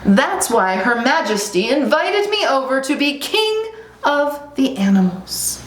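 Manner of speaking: high, pompous little lion; boastful lion cub. Acted.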